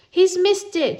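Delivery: high, loud jeering yell. Acted. Giles yelling at the boy who missed, high and loud. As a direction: yell it out scornfully. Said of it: "Giles yelled".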